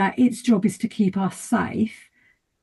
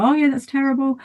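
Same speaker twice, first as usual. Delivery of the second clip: higher, mock-sympathetic friend agreeing; 6 semitones higher than usual. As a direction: play the friend who just agrees, a touch hammed.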